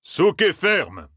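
slow, high-pitched command; called-out order. A narrator's reading. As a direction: call the order out to rowers. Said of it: "lança-t-il". (ordre lancé aux rameurs)

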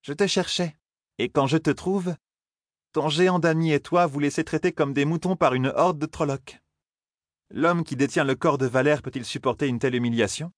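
high feminine character voice, reproachful; haughty young woman. Acted. Selene reproaching Rand for fleeing Trollocs, haughty.

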